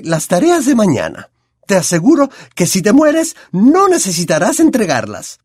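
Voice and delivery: a hint of a strict, impatient professor lecturing; Professor McGonagall. A narrator's reading. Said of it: "en tono práctico".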